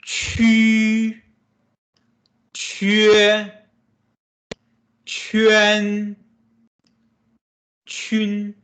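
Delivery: qu, que, quan, chun read as clear model syllables; teacher modeling Mandarin syllables; Mandarin syllables demo. Shown as a demonstration. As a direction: model each syllable for the class. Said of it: "Same thing as you can guess".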